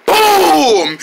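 loud mouth explosion boom; shouted boom. Pretend. Kid making his rocket explode, loud boom. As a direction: blast it out like a bomb.

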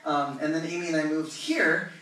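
ordinary talk

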